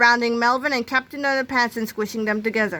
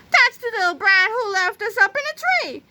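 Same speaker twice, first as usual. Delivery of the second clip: high-pitched shrieked accusation; angry shouting; 12 semitones higher than usual. A narrator's reading. Angry old ladies accuse Melvin of stranding them, high shouting. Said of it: "shouted a couple of very angry old ladies".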